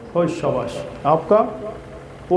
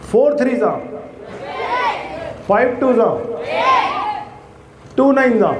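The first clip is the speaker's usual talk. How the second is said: calling out; 7 semitones higher than usual. Shown as a demonstration. Teacher calling tables to class.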